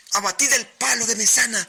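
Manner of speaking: high, loud shouted order over the storm; captain's shout. A narrator's reading. Captain shouting orders to desperate sailors in a storm, loud high shout. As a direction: bellow the command to the crew above the wind. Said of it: "Y el capitán".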